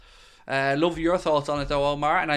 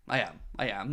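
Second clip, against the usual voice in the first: quiet; 5 semitones lower, 5 dB quieter than usual. Real, not acted. Reluctant admission before "who am I kidding.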